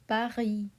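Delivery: Paris said pa-REE with a French R and silent S. Shown as a demonstration. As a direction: drop the S in Paris. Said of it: "We say".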